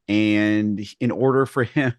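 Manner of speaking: ordinary delivery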